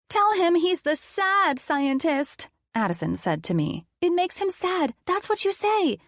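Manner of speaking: put-on high, excited child's voice; little girl. Addison whispering orders to Lena, excited. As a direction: giggle it like an eager little girl.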